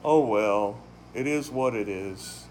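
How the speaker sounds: mock the giving-up attitude, low and listless; resigned shrug. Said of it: "some people's attitude is".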